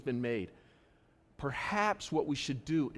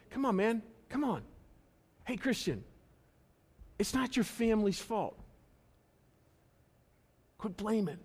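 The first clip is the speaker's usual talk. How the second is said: higher-pitched acted plea; pleading exhortation; 6 semitones higher than usual. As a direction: coax a friend to stop making excuses. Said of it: "And James is saying".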